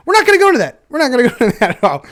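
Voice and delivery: high, loud self-interruption; flustered refusal. Real, not acted. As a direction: shut the topic down loudly, half laughing. Cuts off own oversharing, laughing.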